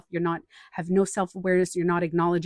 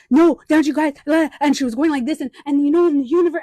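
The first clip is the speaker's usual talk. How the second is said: higher, louder imitation of her ranting; mock upset rant; 8 semitones higher, 10 dB louder, faster than usual. To the calmer upset woman, loud mock rant. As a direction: ham up her frantic complaining.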